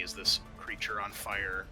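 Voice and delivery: ordinary talk, nothing marked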